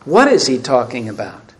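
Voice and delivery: normal talking voice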